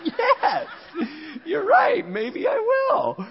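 very high, slow, laughing mock whine; mock hurt reply. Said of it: "I'm like".

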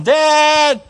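imitating a small boy hollering for water; little boy calling. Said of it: "And the little boy said".

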